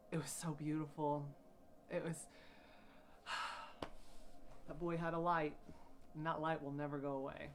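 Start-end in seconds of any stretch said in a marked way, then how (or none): 2.7-4.6 long, soft, voiceless exhale; heavy sigh. Real, not acted. Host remembering her late friend, long soft sigh. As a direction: let out a long emotional breath.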